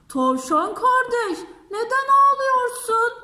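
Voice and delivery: high, loud, singsong bear voice. Acted. Bear asks the crying rabbit in a high singsong voice. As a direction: ask gently in a lifted, singing voice.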